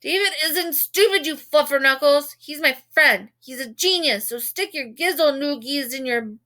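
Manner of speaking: high, loud raging tirade; furious outburst. A narrator's reading. Michael defending David from the reporter, loud furious tirade. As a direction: blast him with made-up curses. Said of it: "that's when Michael exploded".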